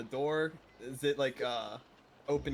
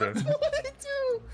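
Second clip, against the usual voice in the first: excited cheer; 7 dB louder, much slower than usual. Real, not acted. Dice roll cheer.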